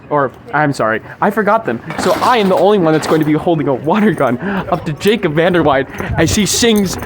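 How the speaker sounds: normal talking voice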